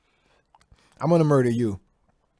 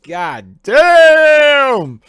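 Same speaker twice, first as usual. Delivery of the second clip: long, high, loud drawn-out yell; big shout; 14 semitones higher, 17 dB louder than usual. Real, not acted. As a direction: belt it out in shock. Huge exclaim.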